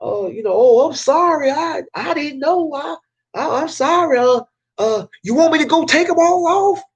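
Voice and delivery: high-pitched Southern bumpkin playing dumb; played-up Southern accent. Flyer guy apologizing for trespassing, played-up Southern accent. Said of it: "play up my Southern accent".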